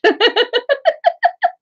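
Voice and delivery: a high, bright burst of laughter; high amused laugh. Real, not acted. Streamer laughing at a viewer's Irish guess, high laugh.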